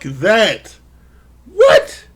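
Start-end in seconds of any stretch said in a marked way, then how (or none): 1.5-2.1 sharp high squeak of disbelief; shocked what. Real, not acted. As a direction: jump way up in pitch, stunned. Shocked at the confession.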